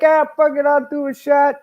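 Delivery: high, careless mock voice; mock drunk commentator. Imitating the announcer deciding to drink, high and careless. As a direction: toss it off like a tipsy announcer. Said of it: "You're like".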